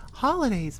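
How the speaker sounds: normal talking voice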